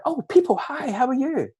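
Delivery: high, delighted puppy greeting; happy dog voice. Retold or imitated. Owner voicing her dog greeting people in the street, high and delighted. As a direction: squeal hello like a dog meeting strangers. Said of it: "Where she's just like".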